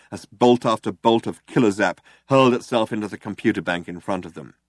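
ordinary talk

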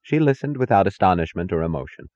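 ordinary delivery

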